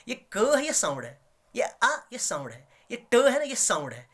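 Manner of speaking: ordinary talk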